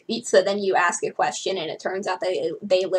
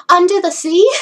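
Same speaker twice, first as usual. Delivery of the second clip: loud, high, eager "Under the sea?"; hopeful eager guess; 9 semitones higher, 12 dB louder than usual. Acted. Blue guesses the dance theme for the teacher, eagerly hopeful. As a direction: pipe up full of hope. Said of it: "such a hopeful idiot".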